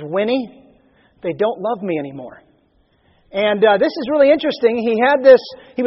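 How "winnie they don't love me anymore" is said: soft, low, hurt confession; heartbroken. Retold or imitated. Churchill tells his wife, Winnie, softly and heartbroken. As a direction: sigh it out like a wounded old man. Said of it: "And he was heartbroken".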